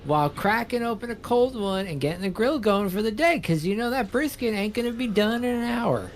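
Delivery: put-on high-pitched Southern accent, stretched out. Streamer having some fun with a put-on Southern accent. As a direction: play a barbecue-loving Southerner with a thick accent. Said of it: "doing that accent around".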